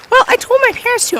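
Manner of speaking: put-on high-pitched little boy voice; whiny kid. Cousin answers back about his parents, in a high kid voice.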